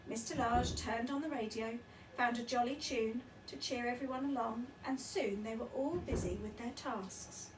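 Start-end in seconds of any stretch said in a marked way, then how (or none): none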